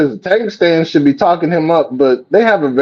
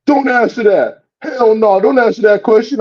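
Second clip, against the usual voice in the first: mocking the handlers; 7 semitones higher than usual. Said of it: "Talking about".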